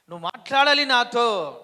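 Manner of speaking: loud, high pleading cry; the girl praying, crying aloud to God; loud crying plea. Retold or imitated. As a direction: demand it of God through tears. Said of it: "గట్టిగా ఏడుస్తూ అడిగినట".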